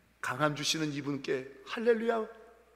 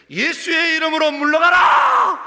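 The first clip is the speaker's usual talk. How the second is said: higher, louder, slow rebuke of a spirit; a child's bold mock exorcism shout; commanding shout; 11 semitones higher, 13 dB louder, slower than usual. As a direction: cast the ghost out with authority. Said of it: "이렇게 얘기하는 거예요".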